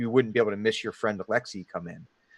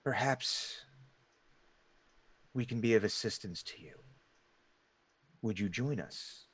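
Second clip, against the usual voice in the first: very soft, halting hooded stranger; deep gravelly voice; 11 dB quieter than usual. Acted. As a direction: offer it quietly, as if from far away. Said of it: "deep, gravelly voice that seems almost far away".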